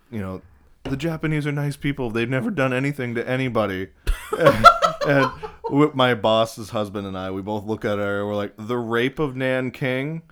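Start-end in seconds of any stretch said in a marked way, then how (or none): 0.8-3.9 lightly mocking, higher-pitched naive voice; raised-pitch mock innocence; young woman quoted. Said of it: "she goes". 8.6-10.2 slow, pointed listing, rising to loud laughter; incredulous retort. Retold or imitated. Retold incredulous retort to her.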